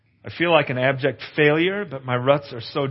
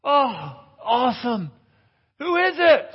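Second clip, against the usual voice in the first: high, eager relieved voice; a dangling man thrilled to be heard; 10 semitones higher, slower than usual. Retold or imitated. Man on cliff answering voice.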